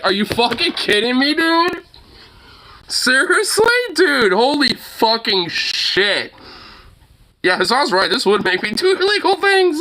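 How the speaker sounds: very high, incredulous rant; exasperated disbelief. Real, not acted. Viewer reacting to an insane ruling, exasperated and high. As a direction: pitch soaring in real outrage. Ranting at the repeated refusal.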